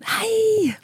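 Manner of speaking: cheery Norwegian hello. Retold or imitated. Father answering his daughter's call, high cheerful Norwegian greeting. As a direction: greet like a beaming Norwegian grandpa. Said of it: "han är norrman".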